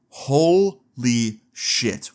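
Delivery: each word drawn out very slowly; acted astonishment, stretched right out; stunned disbelief. Brother hearing the babysitter secret, mock stunned disbelief.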